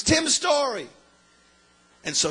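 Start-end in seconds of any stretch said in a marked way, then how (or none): 0.0-0.9 high-pitched cry of recognition; imitated scream. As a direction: call the name out high, mimicking her. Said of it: "she's screaming".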